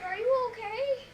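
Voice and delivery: high, loud, slow questioning; exaggerated, drawn-out disbelief; mock concern. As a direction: imitate a baffled pupil checking on the teacher. Acted reply to bored teacher.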